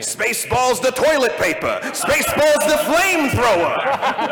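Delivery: loud, high hawker's shout; mock sales pitch, building to a shout; comic pitchman. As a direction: hawk it like a hammed-up merchandiser. Quoting comic merchandise pitch from film.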